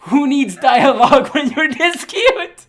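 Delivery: high, loud, slow gushing. Real, not acted. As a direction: squeal it with delight. Gushing over cute anime girls.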